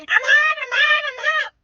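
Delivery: very high, imitated five-year-old yell; mock child's yelling. Five-year-old complaining from the car seat, high mock yelling. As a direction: wail it like an overheated little kid. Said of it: "my five-year-old starts yelling".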